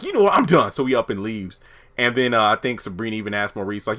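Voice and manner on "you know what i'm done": much higher and louder, done with it all; fed-up outburst. Retold or imitated. Calvin quitting on Sabrina at the bank, fed-up outburst. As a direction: blow up and quit on the spot.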